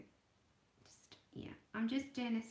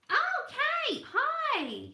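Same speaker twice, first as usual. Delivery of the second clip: excited greeting; 10 dB louder, much slower than usual. Real, not acted. Greeting a viewer in chat.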